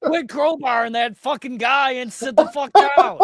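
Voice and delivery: hammed-up driver yelling through a laugh; mock bus driver yell. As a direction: scold the passenger like a fed-up driver.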